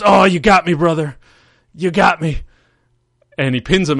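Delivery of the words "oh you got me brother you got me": higher, louder Hulk Hogan voice, hammed-up pain; loud mock wail of defeat; Hogan groan. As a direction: overplay a hurt wrestler crying out. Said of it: "Hogan just goes".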